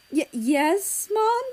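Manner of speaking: sheepish, caught red-handed; caught child. A narrator's reading. Australia answering his parent nervously.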